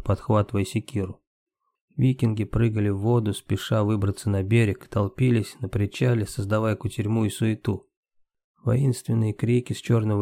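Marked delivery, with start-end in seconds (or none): none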